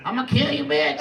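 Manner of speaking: put-on impression of a threatening cowboy; Joe Exotic. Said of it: "That's what he sounded like".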